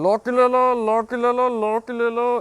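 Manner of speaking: loud, high hawker's singsong; loudspeaker hawker call. Retold or imitated. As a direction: call out like a street vendor over a loudspeaker. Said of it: "वो बोलता है". (ज़ोर से फेरीवाले की पुकार)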